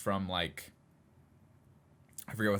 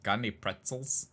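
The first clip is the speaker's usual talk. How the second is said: lightly imitated [name] mumble; a hint of his lazy [name] delivery; just a touch of the [name] voice, offhand. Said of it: "in his stupid [name] voice goes".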